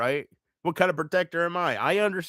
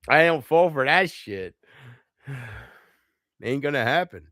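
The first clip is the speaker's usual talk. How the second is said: usual speaking voice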